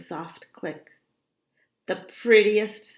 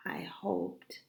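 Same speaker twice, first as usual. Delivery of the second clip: hushed afterthought; very soft, almost voiceless; whispered doubt; 13 dB quieter than usual. A narrator's reading. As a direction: drop to an uncertain whisper. Uncertain afterthought after the escape.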